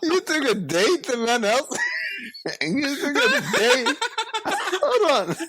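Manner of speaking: high laughing shout; laughing disbelief. Real, not acted. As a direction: howl it out through laughter.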